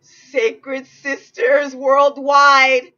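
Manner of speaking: high, loud, slow ecstatic cheer; ecstatic shout. Real, not acted. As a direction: belt it out with pure joy. Ecstatic shout of brand again.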